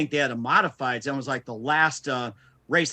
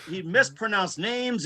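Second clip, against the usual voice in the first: ordinary talk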